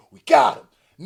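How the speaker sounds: loud triumphant gloat; gloating shout. Retold or imitated. Repeating the execs' line as a loud gloat. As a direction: crow it out loud. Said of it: "repeats it loud".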